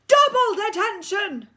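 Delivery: high, loud drawn-out bellow; deputy head screaming the punishment; furious shout. Acted. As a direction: roar detention at the school.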